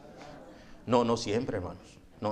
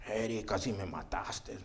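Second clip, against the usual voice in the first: low, soft and slow, imitating the invalid; drained, reproachful voice of a convalescent; weak patient; 6 semitones lower, 11 dB quieter, slower than usual. Thin pale boss to Eric after hospital, weakly reproachful.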